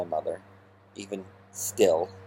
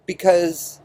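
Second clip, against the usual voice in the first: laughing; 9 semitones higher, 4 dB louder than usual. Real, not acted. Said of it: "I started laughing".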